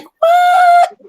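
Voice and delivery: high, loud shout of disbelief; shocked outburst. Retold or imitated. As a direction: blurt it out loud and very high, appalled. Acted shocked reply in retold scene.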